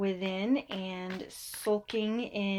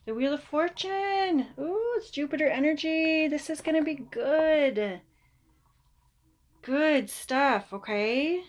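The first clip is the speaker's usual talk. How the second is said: higher and louder, bubbling over; excited; 4 semitones higher, 4 dB louder than usual. Real, not acted. Reader cheering Cancer about the Wheel of Fortune, excited. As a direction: celebrate the card like great news. Excited reveal of good card.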